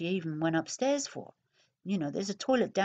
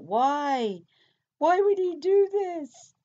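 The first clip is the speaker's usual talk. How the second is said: high, slow, tearful imitated wail; imitating breaking down; 10 semitones higher, 6 dB louder, much slower than usual. Retelling her police interview, a high imitated tearful wail. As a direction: mimic her falling apart, voice pitched way up. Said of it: "she starts breaking down about".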